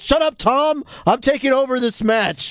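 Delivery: hammed-up brushing his partner aside; bossy commentator. Mocking Corey Graves cutting off his partner Tom, bossy. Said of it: "he goes".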